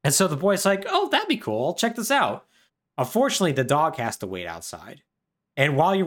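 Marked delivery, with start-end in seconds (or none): 0.9-2.4 put-on high, keen kid voice; eager boy. Boy decides to visit the freak show, high eager voice. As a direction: sound like a curious kid.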